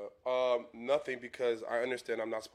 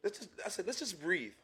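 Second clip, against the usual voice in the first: soothing daddy; 7 semitones higher, slower than usual. Retold or imitated. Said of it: "I be like".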